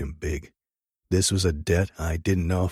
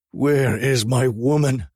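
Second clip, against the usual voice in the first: slow, loud, raised roar; 7 semitones higher, 5 dB louder, slower than usual. A narrator's reading. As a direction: roar it out in despair. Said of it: "I roared".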